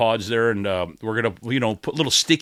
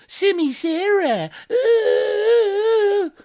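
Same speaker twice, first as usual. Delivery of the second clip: mocking whine; 6 dB louder, much slower than usual. Mocking a radio host.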